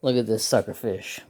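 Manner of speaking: ordinary talk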